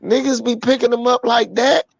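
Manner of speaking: high incredulous question; retold disbelief. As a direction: replay your baffled question to a friend.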